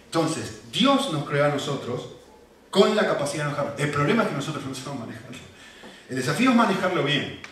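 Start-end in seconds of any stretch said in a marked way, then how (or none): none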